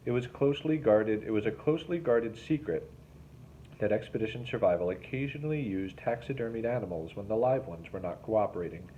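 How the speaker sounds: usual speaking voice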